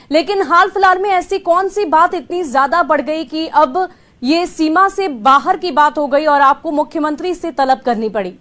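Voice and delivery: usual speaking voice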